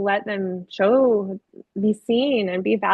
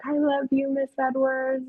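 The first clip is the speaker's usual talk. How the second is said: higher, softly voiced little child; imitating a pupil; 4 semitones higher, 3 dB quieter than usual. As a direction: play a young pupil's soft declaration of love. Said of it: "came up to me and was like".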